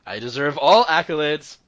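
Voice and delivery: loud, high mock boast; mock self-congratulation. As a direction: proclaim it grandly, as a joke. Mock boast after a pun.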